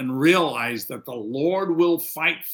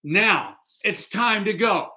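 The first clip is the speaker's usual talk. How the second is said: God ending the pleading, firm and final; preacher voicing God with authority; commanding God; 8 semitones higher, 3 dB louder than usual. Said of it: "God says".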